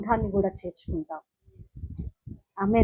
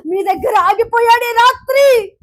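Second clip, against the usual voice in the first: loud, raised preaching; preaching at full force; 11 semitones higher, 10 dB louder than usual. Acted. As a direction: drive the promise home to the crowd.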